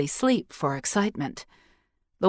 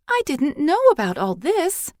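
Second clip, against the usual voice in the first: put-on high, eager child asking; a curious little girl, pitched high; young girl's voice; 10 semitones higher, 3 dB louder than usual. A narrator's reading. Girl questioning the old priestess, high and eager.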